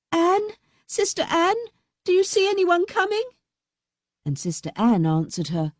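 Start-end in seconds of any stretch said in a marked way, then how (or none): 0.1-3.2 high anxious call upward. A narrator's reading. Fatima calling up to her sister. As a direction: call up the tower in fear. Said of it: "the unhappy Fatima cried up to her".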